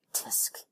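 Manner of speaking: disapproving tongue click. A narrator's reading. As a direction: tsk of mild disapproval. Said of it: "Helena clicked her tongue".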